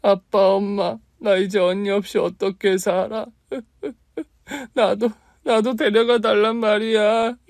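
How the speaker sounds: high, loud crying plea; sobbing plea. Acted. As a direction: beg through sobs to be taken too. Wailing at parents' grave.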